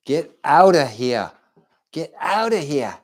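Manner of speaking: put-on high-pitched Jersey accent. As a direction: brush them off in a Jersey accent. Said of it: "How do they say it in Jersey?".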